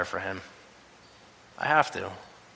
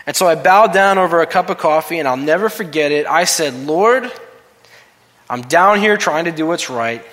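louder preached quote of King's prayer; preaching King's words; 4 semitones higher, 7 dB louder than usual. Acted. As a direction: preach it with rising fervor. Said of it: "These are his words, exactly his words".

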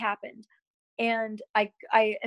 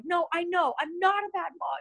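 higher, a touch of mock crying on bad mom; teary reply; 9 semitones higher than usual. Wife answering her husband tearfully, with a hint of crying. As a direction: answer him through retold tears.

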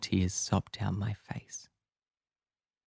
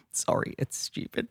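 a high, breathy, sob-choked apology; broken little sorry while crying; tearful apology. Acted. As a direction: squeeze it out through tears. Crying after confession.

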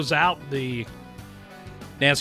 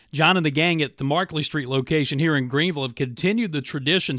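upbeat, louder radio ad read; 4 semitones higher, 5 dB louder than usual. Acted. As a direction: sell it like a radio spot. Host reads live radio ad.

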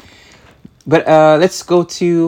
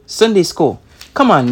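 usual speaking voice

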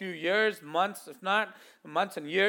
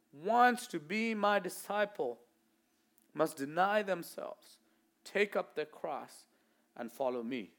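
slow, softer, fading solemn read; solemn scripture reading; 3 semitones lower, 6 dB quieter, slower than usual. Acted. Preacher reading the verse to the church, solemn. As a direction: let each phrase sink in.

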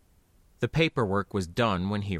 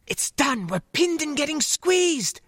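whispered fury. Acted. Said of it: "she hissed".

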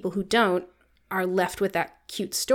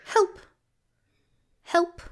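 high, tiny mouse voice; tiny squeaky critter; 8 semitones higher than usual. Retold or imitated. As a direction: squeak it like a timid little mouse. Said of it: "quiet little mouse voice".

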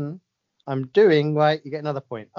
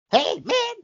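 much higher, bouncy comic's voice; Kevin Hart impression. Quiz host impersonates Kevin Hart for co-host, squeaky and high. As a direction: chirp it up high like a hyped comedian. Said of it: "I don't even know how this guy talks".

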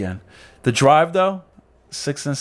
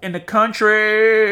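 country drawl; 10 semitones higher, much slower than usual. Pretend.

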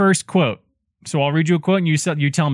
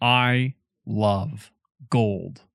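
slow drawn-out quote; 6 semitones lower, 4 dB quieter, much slower than usual. Retold or imitated. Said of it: "here we go".

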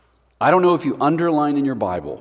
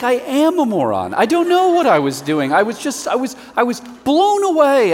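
high, self-mocking Peter, a bit louder; sheepish Peter; 10 semitones higher, 5 dB louder than usual. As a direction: admit it as a bewildered Peter. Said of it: "He's like".

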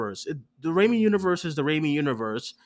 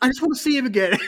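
high, louder goofy pleading voice; Jacob Batalon impression; 9 semitones higher, 5 dB louder, faster than usual. Telling co-hosts about a funny movie line, high goofy pleading. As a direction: mimic a goofy friend begging to see him. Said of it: "Jacob Batalon is so funny 'cause he's like".

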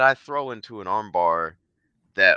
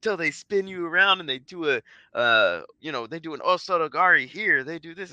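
mocking keyboard warrior; 9 semitones higher, 4 dB louder than usual. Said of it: "keyboard warriors on here are like".

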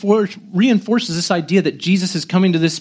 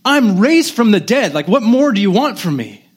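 higher-pitched, incredulous mock outburst; volatile exasperation; 6 semitones higher than usual. Preacher imagining himself as Jesus facing doubting disciples, volatile exasperation. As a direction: argue in disbelief, as if badly affronted. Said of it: "'cause I'm very volatile".

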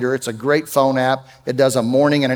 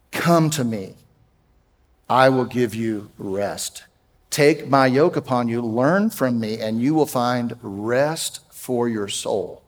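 softer, slower and lower, almost a comfort; tender reassurance; 3 semitones lower, 4 dB quieter, slower than usual. Acted. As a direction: speak it gently to anxious listeners. Repeats verse after anxiety talk.

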